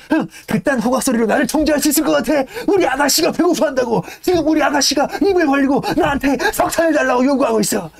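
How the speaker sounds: mock the whistle and dote on the furnace; defiant stoker. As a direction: Yank scorning the whistle while feeding his beloved furnace, high and cocky. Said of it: "이러면서 열심히".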